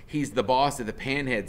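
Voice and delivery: plain reading voice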